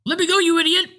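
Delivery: put-on old man pushes the narrator aside, high and cross; irritated old man. A narrator's reading. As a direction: shove past him with a piping snap. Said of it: "in a thin, piping voice".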